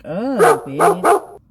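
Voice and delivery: loud, sassy retort; acted-out loud comeback; mock outraged retort. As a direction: blurt it out loud at a rude woman. Retold retort to rude lady.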